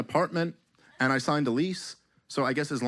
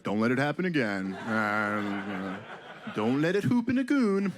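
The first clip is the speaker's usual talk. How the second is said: mock Canadian accent, mild and polite, "hoop in a goon" said for "happen again"; a meek Canadian scolding, "again" as "a goon"; passive Canadian; 4 semitones lower than usual. Canadians to Americans, meek mock-Canadian scolding. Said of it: "they'll be passive about it".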